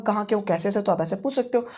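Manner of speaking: ordinary talk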